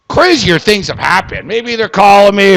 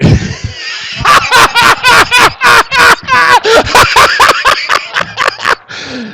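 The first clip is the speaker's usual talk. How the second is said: big high shrieking laugh burst. Real, not acted. Huge laugh at own joke.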